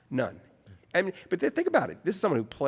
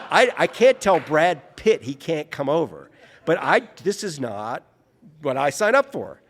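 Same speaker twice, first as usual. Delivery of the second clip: exasperated outburst; 4 semitones higher, 8 dB louder than usual. Retold or imitated. Agent furious Brad Pitt visiting.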